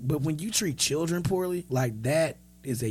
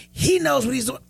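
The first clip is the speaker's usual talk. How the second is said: sharp, high and loud retort; snippy retort; 10 semitones higher, 6 dB louder than usual. Retold or imitated. Old lady snapping back at the storyteller, higher and louder.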